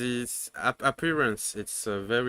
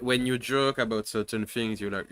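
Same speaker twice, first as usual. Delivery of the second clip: ordinary talk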